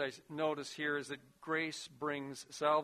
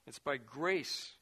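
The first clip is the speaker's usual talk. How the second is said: ordinary talk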